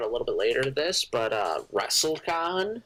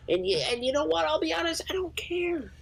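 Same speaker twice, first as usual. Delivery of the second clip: high, dismissive. Acted. Rising to whisper.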